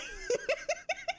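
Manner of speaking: high-pitched cackling giggle; amused laugh. Real, not acted. Streamer baffled by a player's build, amused laugh.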